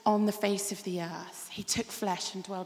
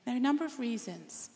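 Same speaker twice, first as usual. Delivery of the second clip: normal talking voice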